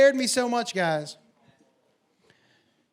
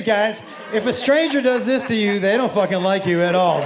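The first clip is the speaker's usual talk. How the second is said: usual speaking voice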